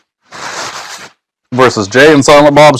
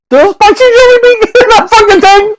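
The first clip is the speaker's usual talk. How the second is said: shocked; 3 dB louder than usual. Real, not acted. Shocked by a spicy bean.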